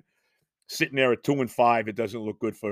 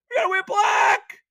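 mocking shout; 6 dB louder than usual. Host yelling the owner's uniform excuse back at him, mocking shout.

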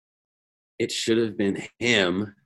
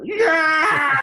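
mock falling scream; 3 dB louder than usual.

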